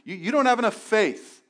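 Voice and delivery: imitating someone blaming lack of faith; preachy judgment. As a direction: parody a judgmental churchgoer. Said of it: "We'll say things like".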